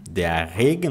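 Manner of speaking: ordinary talk, nothing marked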